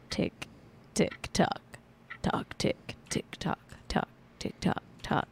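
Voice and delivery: soft whispered tick tock; whispered clock ticking. Acted. Opening the Hot Seconds segment with a whispered ticking clock. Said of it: "segment I like to call Hot Seconds".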